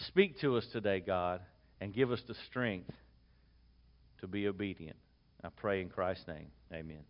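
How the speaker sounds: soft reverent pulpit prayer. Acted. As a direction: pray quietly and earnestly to God. Opening prayer before the message.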